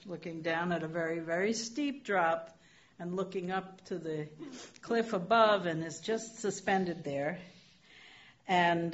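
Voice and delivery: ordinary delivery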